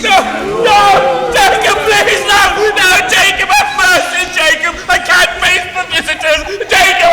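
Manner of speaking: high, loud desperate begging; panicked pleading shout. Acted. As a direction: cry out after the vanishing ghost. Scrooge begs vanishing ghost.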